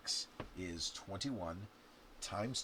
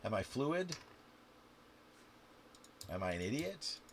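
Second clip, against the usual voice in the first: usual speaking voice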